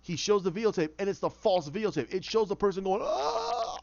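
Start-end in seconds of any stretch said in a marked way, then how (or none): 3.0-3.8 sudden high strangled gasp; a choked last breath as he dies; dying gasp. Retold or imitated. Man on the tape gasping as he dies.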